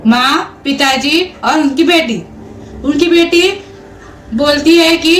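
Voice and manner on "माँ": plain reading voice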